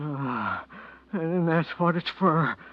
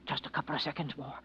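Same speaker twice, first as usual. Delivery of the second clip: much softer, tense under breath; husband hushing while money burns; hushed urgency; 5 semitones lower, 13 dB quieter than usual. Acted. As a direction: murmur it to himself. Stalling police while money burns.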